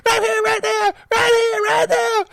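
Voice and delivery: put-on high, loud, drunken shouting demand; mock drunk woman yelling; drunk girlfriend. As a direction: shout it like a tipsy girlfriend itching to wrestle.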